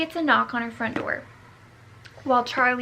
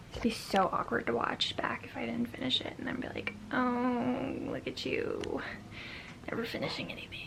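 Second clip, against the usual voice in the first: whispered self-mockery; 7 dB quieter than usual.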